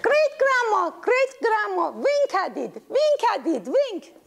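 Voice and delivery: put-on high, eager kid voice; child voice. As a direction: urge her like an excited child. Child instructs grandma.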